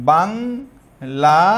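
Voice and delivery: Bangladesh very slowly, each syllable held and set apart; exaggerated syllable split. Shown as a demonstration. Teacher demonstrating vowel count to class, Bangladesh hugely drawn out in parts. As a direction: stretch out বাং ... লা ... দেশ. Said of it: "আমরা যদি এটাকে এইভাবে বলি".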